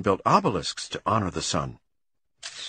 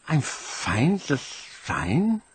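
plain reading voice

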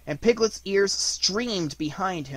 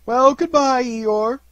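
high, loud, reluctant goodbye; lingering farewell; 9 semitones higher, 9 dB louder, much slower than usual. A narrator's reading. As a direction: call a drawn-out farewell. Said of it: "as lingeringly as they could".

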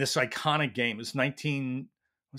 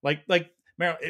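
ordinary talk

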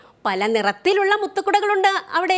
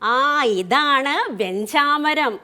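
slow, drawn-out teacher naming the object; slow clear naming; 4 semitones lower, much slower than usual. Shown as a demonstration. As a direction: lay out വെഞ്ചാമരം slowly for little children. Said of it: "ഇതെന്താണെന്നറിയോ".

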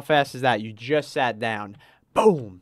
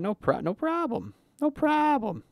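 high, sing-song customer service voice; chirpy waiter voice. Acted. Streamer reassuring a waiting customer in a high chirpy waiter voice. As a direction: play the overeager server.

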